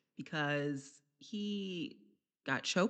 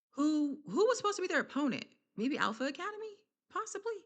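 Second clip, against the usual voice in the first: puzzled guessing; 8 semitones higher, faster than usual. Real, not acted. Guessing who the opponent was.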